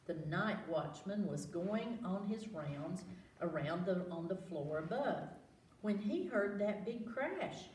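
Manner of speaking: usual speaking voice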